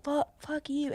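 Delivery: very soft mock defiance; quiet retold swearing. Friend swearing at the tattler, very quiet imitation. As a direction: say it small, under your breath.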